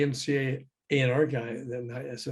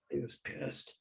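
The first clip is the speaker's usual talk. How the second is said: whispered repeat; 13 dB quieter than usual. Real, not acted. To the host, repeating the punchline, whispered. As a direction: whisper it, much softer.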